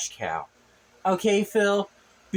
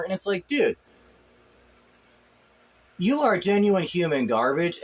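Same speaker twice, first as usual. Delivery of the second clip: ordinary talk, nothing marked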